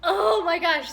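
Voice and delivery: loud, high exclamation; exclaiming. Real, not acted. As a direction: gasp it out still amazed. Reliving the shock.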